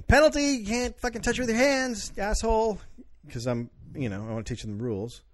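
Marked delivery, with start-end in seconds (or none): 0.0-2.8 mock referee call. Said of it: "And I keep saying".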